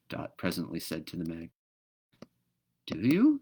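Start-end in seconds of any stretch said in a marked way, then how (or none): 2.9-3.4 high surprised question. Acted. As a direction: sound taken aback. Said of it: "said the magpie with surprise".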